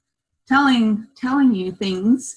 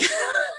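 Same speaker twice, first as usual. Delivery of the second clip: high squeaky laugh tipping into tears; laughing cry; 5 dB quieter than usual. Real, not acted. Remembering the healing session, laughing cry. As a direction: laugh that cracks into a sob.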